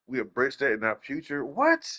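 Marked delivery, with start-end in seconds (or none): 1.4-1.9 high, disbelieving "what"; incredulous. Real, not acted. Reacting to the company's live service line, incredulous. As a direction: shoot the word up high in disbelief.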